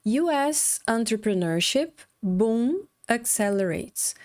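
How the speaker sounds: U S entrepreneurship boom accelerates read slowly in English; slow headline read. Shown as a demonstration. Teacher reads the Forbes headline to students, slow and clear. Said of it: "a notícia de hoje é".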